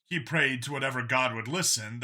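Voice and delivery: ordinary delivery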